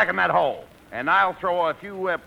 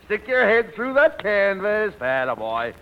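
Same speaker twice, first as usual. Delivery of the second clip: normal talking voice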